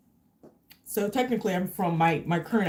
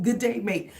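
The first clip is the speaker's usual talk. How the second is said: "Good day, mate" in a laughing mock Australian; playful Australian hello; Australian accent; 2 semitones higher than usual. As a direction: chuckle into an Aussie greeting. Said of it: "This is an Australian".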